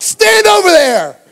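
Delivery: high, drawn-out shouted order; angry yelling. Retold or imitated. Dad ordering his daughter away, angry shouting. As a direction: bellow it slowly like a mad dad. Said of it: "I'm, like, yelling at her".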